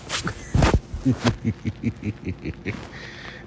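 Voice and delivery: long, breathy giggle; drawn-out wheezy chuckle in pulses; amused laugh. Real, not acted. Speaker cracking up over being read nicely, long amused laugh.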